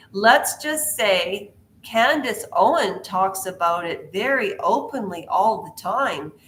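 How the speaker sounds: ordinary delivery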